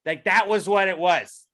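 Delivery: usual speaking voice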